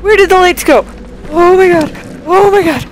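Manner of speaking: screaming in panic in the dark; panicked shouting. Real, not acted. Lights go out, panic.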